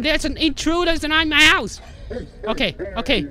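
normal talking voice

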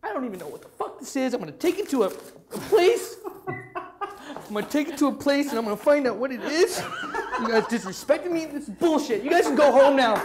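high, indignant rant building to a shout; comically outraged impression of an offended friend; imitated freak-out. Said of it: "So he freaks out".

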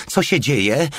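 a touch of fright, understated; alarmed cry. A narrator's reading. Woman hearing gunshots in the street, mildly alarmed. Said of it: "krzyknęła przerażona".

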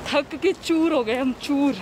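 muffled behind cloth mask; speaking while walking masked; through a face mask. Real, not acted. Seen 3: Cloth mask covers mouth and nose throughout; reference frames unmasked.

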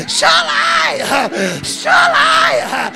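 sermon climax cried out in a very high voice; high whooped cry. Real, not acted. Preacher crying out the psalm's promise, high whoop. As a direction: leap far up into a stretched, wailing holler.

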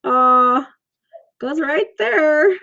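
speaking with finger at lips; finger touching teeth. Real, not acted. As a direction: fingertip pressed on front teeth. Seen 0: Fingertips rest on lips and front teeth while speaking, laughing.

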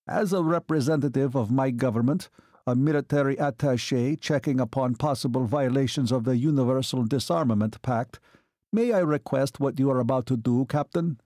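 formal, official foreign officer voice; Hungarian colonel. Acted. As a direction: speak as a courteous military attaché. Hungarian colonel addresses Joe formally.

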